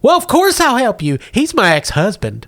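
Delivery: high-pitched housewife, readily agreeing; Gamorrean woman. Acted. Woman won over against her ex, high-pitched. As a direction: agree to help in a high character voice. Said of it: "A middle-aged Gamorrean woman".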